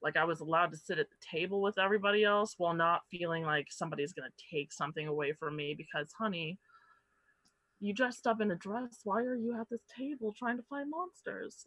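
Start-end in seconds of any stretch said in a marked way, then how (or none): 6.1-11.6 mocking condescension. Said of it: "it was just very".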